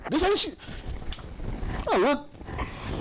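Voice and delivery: ordinary delivery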